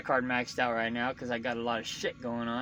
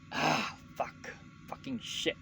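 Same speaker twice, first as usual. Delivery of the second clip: whispered swearing, much softer, under his breath; muttered curse; 9 dB quieter, slower than usual. Real, not acted. Trucker alone with his troubles, whispering a curse. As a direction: curse quietly to yourself, nearly voiceless.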